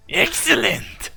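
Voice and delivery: high, slow mock-villain "Excellent"; copied sinister voice. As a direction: echo the hammed-up sinister "Excellent". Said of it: "the exact way you said that".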